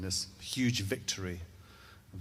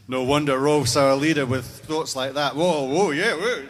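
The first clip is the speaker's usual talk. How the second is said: much louder, higher, hollering whoas; rowdy cheering warriors; 4 semitones higher, 11 dB louder than usual. Acted. The Viking men cheer their leader Rolf, loud raucous whooping. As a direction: whoop it up like drunk men in a mead hall.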